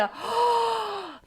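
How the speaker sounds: sharp high gasp of shock. Real, not acted. Streamer gasping at the shocking scene. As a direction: gasp as the twist lands.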